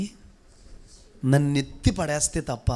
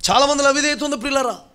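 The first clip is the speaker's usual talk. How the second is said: high, loud preaching to the congregation; full-force preaching; 7 semitones higher, 7 dB louder than usual. Acted. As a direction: thunder it from the pulpit, building. (పెద్ద గొంతుతో ప్రసంగం)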